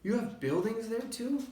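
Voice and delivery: astonished; amazed question. A narrator's reading. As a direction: gasp out the question in surprise. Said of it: "Horton gasped".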